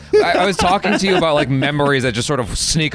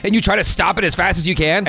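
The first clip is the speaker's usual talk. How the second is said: ordinary talk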